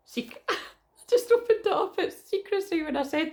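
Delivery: high-pitched giggly laugh. Real, not acted. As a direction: laugh through the words. Laughs at own slip.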